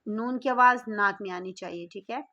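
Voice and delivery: fast explanation. Real, not acted. Teacher explaining quickly.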